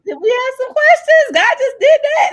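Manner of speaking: high, loud laughing exclaim; laughing shout. Real, not acted. Host marvels at the talk, laughing out loud. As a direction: burst out laughing in joy.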